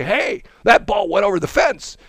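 high, indignant mock coach; lightly voiced coach calling out; coach complaining. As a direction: protest the call. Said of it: "the coach comes out and say".

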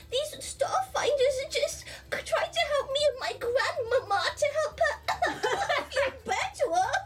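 put-on very high, babyish little-creature voice; cutesy pleading. Little character bluffing a shopkeeper about grandmama, squeaky hammed-up pleading. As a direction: plead in a tiny put-on voice, breaking up.

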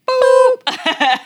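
put-on high, loud kid's boop; mock censor beep. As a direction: bleep out the word like a kid. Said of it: "he censors himself".

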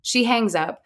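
usual speaking voice

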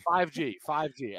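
"Five G, five G" said slowly and clearly; slowed down. Real, not acted. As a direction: spell it out slowly so they catch it. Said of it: "go slower with the symbols".

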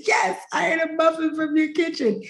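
insist, high and frantic. Retold or imitated. She insists to the host that the muffin came from his kitchen, high and frantic. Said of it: "And I said".

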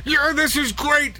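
high, hammed-up radio host enthusiasm; fake radio excitement. Host mocks [name]'s fake excitement on air, hammed-up. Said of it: "that fake excitement of his".

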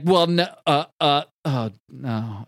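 acted crestfallen hesitation; deflated stammer. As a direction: falter and trail away in defeat. Rasputin's argument collapses.

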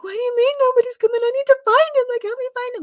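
high, loud and fast desperate plea; begging staff to find him, near panic; frantic pleading. Retold or imitated. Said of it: "I was going nuts".

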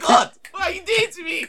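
very high, loud laughing squeal; hammed-up disbelief; shrieking astonishment; comic shock. Shocked she knew his name.

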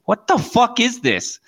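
high, slow incredulous outburst; retold disbelief. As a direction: act out your baffled disbelief, drawn out. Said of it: "Like that".

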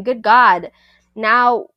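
usual speaking voice

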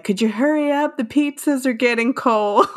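playful quote of an impatient delivery guy; truck driver. Retold or imitated. Host quoting the pizza driver hurrying someone, truck driver voice. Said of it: "in the next scene when he's like".

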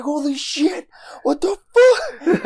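high squealed disbelief, breaking into laughter; retold thrilled shock. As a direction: reenact a stunned, overjoyed outburst, high and drawn out. Retells own reaction to surprise gift.

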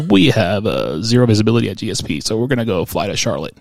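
low, loud pilot announcement voice; airline captain. Retold or imitated. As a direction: parody a captain giving news over the intercom. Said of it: "the pilot goes".

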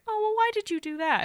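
high, airy, oblivious question; mock-placid high housewife voice; imitating stoic unconcern. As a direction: ask it sweetly, as if nothing happened.

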